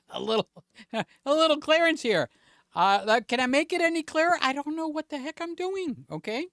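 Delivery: high, laughing through the words; nervous laughing. Real, not acted. Flustered streamer laughing to his viewers. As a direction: giggle while admitting you're lost. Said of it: "I got myself nervous enough".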